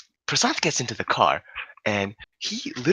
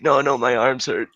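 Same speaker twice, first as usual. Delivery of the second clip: higher, louder mock whining; imitated pained complaint; 4 semitones higher, 6 dB louder than usual. Drunk friend protests after push-ups, louder mock pained whine.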